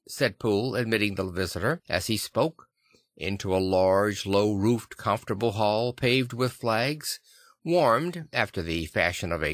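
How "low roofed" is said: plain reading voice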